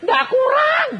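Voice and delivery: high, loud, emphatic "Ndak kurang"; emphatic denial. Retold or imitated. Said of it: "retold answer about money". ("Ndak kurang" diucapkan tinggi dan keras)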